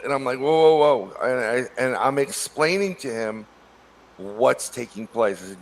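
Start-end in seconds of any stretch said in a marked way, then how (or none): 0.4-1.3 louder startled whoa, backing off. Retold or imitated. Retelling backing off from the furious biker, loud startled whoa. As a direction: throw up your hands, whoa.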